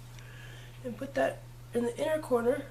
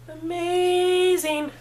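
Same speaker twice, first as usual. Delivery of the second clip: higher, louder, very slow "Amazing"; drawn-out amazing; 8 semitones higher, 6 dB louder, much slower than usual. Pretend. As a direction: stretch "Amazing" out like a diva. Correcting amazeballs with a flourish.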